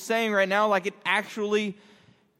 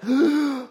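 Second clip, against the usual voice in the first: big inhale; 5 semitones higher than usual. Shown as a demonstration. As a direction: demonstrate breath rushing back in. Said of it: "takes a big inhale".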